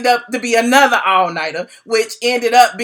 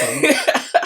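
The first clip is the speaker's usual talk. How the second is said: mock friendly greeting; 3 semitones higher than usual. Blogger hailing her estranged former lawyer, mock friendly. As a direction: sing out an over-friendly greeting.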